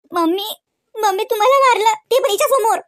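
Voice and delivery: wailing tearful plea; tearful complaint. Acted. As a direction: sob it out to mom. Crying to mother in quarrel.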